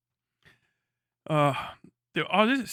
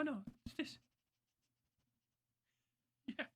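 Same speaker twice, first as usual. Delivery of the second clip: ordinary talk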